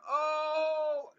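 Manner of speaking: a high, soft gasp of an oh; stunned oh. Retold or imitated. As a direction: let the shock catch in your throat. Hears friend's thirty-one years.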